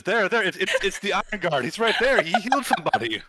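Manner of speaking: loud, high-pitched excited outcry; excited shouting. Acted. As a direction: call out excitedly to the whole crowd. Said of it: "who's talking excitedly to these other individuals".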